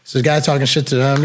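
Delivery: usual speaking voice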